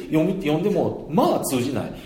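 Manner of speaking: ordinary talk, nothing marked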